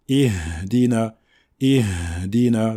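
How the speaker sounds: "Ihdina" slowly, lingering on the H; Ha given time. Shown as a demonstration. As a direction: give the H in "Ihdina" its full time. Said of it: "Il faut leur donner leur temps".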